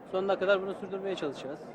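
usual speaking voice

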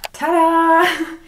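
high, loud bubbly reveal; excited ta-da. Real, not acted. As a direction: announce it with a flourish. Revealing finished look.

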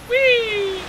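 high, loud squeal of fun; playful wheee. Pretend. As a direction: squeal as if riding a slide. Riding the current in game.

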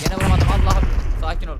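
loud, high yell after missing the shot; frustrated shout. Real, not acted. Gamer yelling at himself after a missed shot, loud frustration. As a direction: blow up at yourself for missing.

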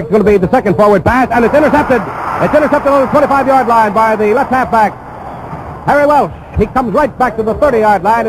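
rising thrill of a sudden interception; excited call. Real, not acted. Radio announcer calling the interception to listeners, excited. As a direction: pitch jumps way up as the pass is picked off.

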